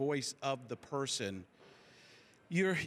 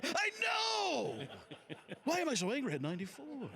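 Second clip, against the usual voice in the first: laughing, much higher shouted Shatner voice; Shatner impression; 12 semitones higher than usual. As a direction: burst out indignant as old Shatner. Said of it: "He's like".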